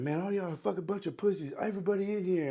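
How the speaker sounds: high-pitched imitation of a ranting instructor; mock challenge. Retold insult to the whole room.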